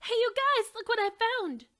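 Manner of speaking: high, loud excited call. Acted. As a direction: call out to friends far off. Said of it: "she called out".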